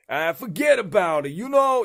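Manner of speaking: loud, high, hammed-up Brooklyn wiseguy; mock Brooklyn accent. Shown as a demonstration. Voice actor showing his co-host a rough Brooklyn attempt, hammed-up Brooklyn accent. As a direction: belt out a caricature Brooklyn accent. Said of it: "like, a Brooklyn accent?".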